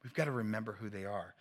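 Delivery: normal talking voice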